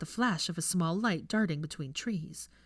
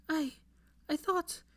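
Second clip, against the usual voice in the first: stammering; 11 semitones higher, slower than usual. Acted. Stammering faltering reply.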